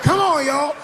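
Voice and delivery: high, loud rally cry; triumphant come on; rallying call. Retold or imitated. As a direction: lead the march. Rallying the crowd.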